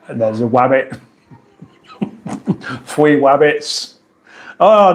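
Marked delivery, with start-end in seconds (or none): 0.2-1.0 "wabbit" for rabbit, with W in place of R; Cockney-style lisped "wabbit". Shown as a demonstration. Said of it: "They kind of go into W's". 2.9-3.9 slow "Three wabbits", both Rs as W; mock Cockney "fwee wabbits". Shown as a demonstration. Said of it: "They kind of go into W's".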